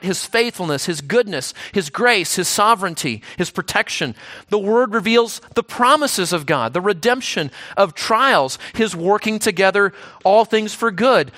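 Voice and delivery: usual speaking voice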